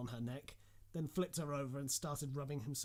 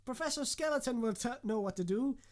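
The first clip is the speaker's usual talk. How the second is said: excited student; 8 semitones higher, 6 dB louder than usual. Acted.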